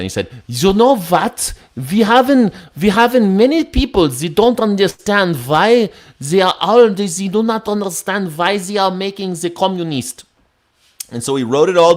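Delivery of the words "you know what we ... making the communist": high-pitched mock German accent. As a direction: do Marx in a hammed-up German accent. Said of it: "he probably said it in a German accent".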